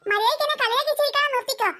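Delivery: ordinary delivery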